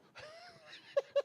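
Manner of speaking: put-on high old lady cackle. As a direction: cackle like a scheming granny. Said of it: "That's my old lady voice right there".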